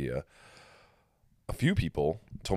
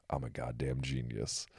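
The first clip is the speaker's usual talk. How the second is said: hushed aside; 4 semitones lower, 10 dB quieter than usual. Retold or imitated. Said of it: "I look at him, I go".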